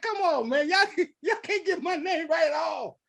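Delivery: high, laughing protest; mock exasperation. Pupil fed up with misread name, mock exasperated.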